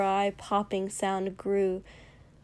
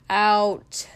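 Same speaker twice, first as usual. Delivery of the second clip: a loud one-word order; called out; 9 dB louder than usual. A narrator's reading. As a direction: bark it over your shoulder. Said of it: "Katsuki called without turning around".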